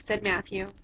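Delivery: ordinary delivery, nothing marked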